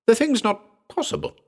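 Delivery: very high, stunned disbelief; stupefied. A narrator's reading. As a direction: blurt it out in shock. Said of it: "I cried, stupefied".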